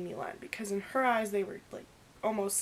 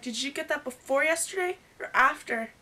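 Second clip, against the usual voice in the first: accusing; 5 semitones higher, 7 dB louder than usual. Retold or imitated. Pressing Milan about the timing.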